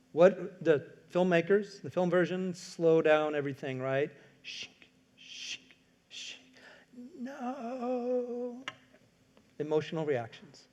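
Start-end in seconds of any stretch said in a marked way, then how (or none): 4.4-8.6 hushed grief. Retold or imitated. Slow-motion grief scene.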